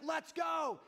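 loud, high rallying cry; hyped shout. Retold or imitated. Dad pumping himself up on the ride, shouting. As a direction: fire everyone up.

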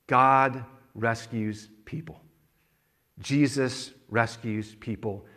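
slow, weighty preaching line; deliberate, measured proclamation; slow declaration. Real, not acted. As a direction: space out each word and let it land. Preacher slowly declares the key message.

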